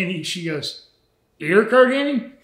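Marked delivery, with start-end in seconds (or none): 1.4-2.3 surprised clerk. Retold or imitated. Clerk recognizing wrestler.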